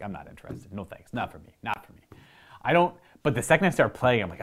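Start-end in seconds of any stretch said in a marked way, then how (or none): 0.0-2.0 soft, flat brush-off; quiet dismissive refusal. Retold or imitated. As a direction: mutter a disinterested no thanks. Brushing off roommate's game invite.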